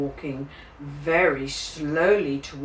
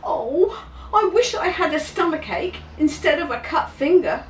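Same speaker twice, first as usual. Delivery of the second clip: put-on envious child; 11 semitones higher, 4 dB louder than usual. A narrator's reading. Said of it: "thought James".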